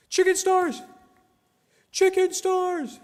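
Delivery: high, loud yelled chicken stars; yelled catchphrase. Real, not acted. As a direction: shout it out while fleeing. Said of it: "I clearly yelled "chicken stars".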